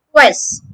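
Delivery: "Quest" with rounded lips, KW, falling; first syllable drill. Shown as a demonstration. Teacher drilling the first syllable, rounded lips. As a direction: isolate "Quest" for students. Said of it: "The first syllable is made with a k".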